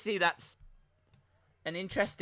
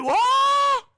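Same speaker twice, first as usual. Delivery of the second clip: very high, loud shocked shout; shocked Gary Horn; 13 dB louder than usual. Acted. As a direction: mimic a stunned man yelling in disbelief. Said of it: "Although Gary Horn is shocked".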